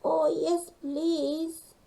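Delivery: high, eager little penguin; grateful cry for help; eager call. Acted. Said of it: "called Gasper".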